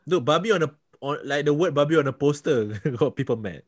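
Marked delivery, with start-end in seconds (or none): none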